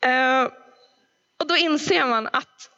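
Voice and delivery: ordinary talk, nothing marked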